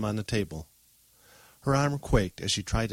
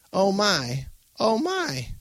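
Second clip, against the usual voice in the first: high-pitched alarmed gasp of words; alarmed old woman; 11 semitones higher, much slower than usual. Acted. Grandmother seeing an omen in her coffee, alarmed and high.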